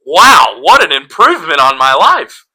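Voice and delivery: loud, high mock enthusiasm; sarcastic amazement. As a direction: gush in exaggerated fake wonder. Mocks friend with exaggerated praise.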